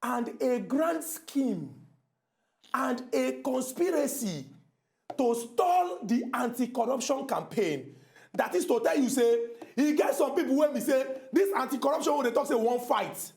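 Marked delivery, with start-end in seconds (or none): 0.0-7.9 slow oration with weighty pauses; showy formal English. Shown as a demonstration. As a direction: deliver each phrase separately, very drawn out. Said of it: "I go speak English".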